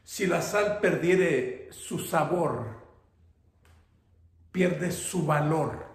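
ordinary talk

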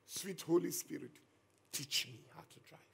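hushed, trusting prayer repeated; soft prayerful plea. Retold or imitated. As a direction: breathe the prayer gently again. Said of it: "Can you hear that faith?".

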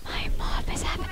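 hushed, voiceless aside; real whisper. Real, not acted. Player notes mom is on the phone, whispering. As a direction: whisper so the call isn't disturbed.

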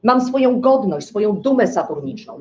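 normal talking voice

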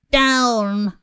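high-pitched witch's cry. A narrator's reading. Witch ordering her broom down, crying out. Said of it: "cried the witch".

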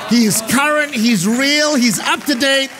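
high, driving preacher's delivery; full-force preaching. Real, not acted. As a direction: proclaim it with rising fervour.

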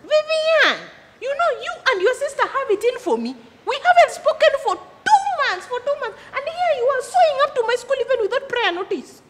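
high-pitched, affronted telling-off; aggrieved and high-voiced; indignant scolding. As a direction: scold a sister with rising exasperation. Scolding sister for turning up unannounced.

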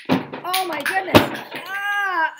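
high, loud startled yelp; startled cry. Acted. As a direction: shriek at the sudden pain. Bumping around in the dark.

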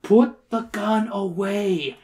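slow, high put-on order; drawn-out urgent command; little guy's cry. A narrator's reading. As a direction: cry it out, stretching each word. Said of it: "cried the little guy".

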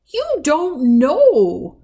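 very slow, loud, ominous granny; dark. A narrator's reading. Granny rebuffing her granddaughter, slow and dark. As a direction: draw it out high as a dark warning. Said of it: "said Granny darkly".

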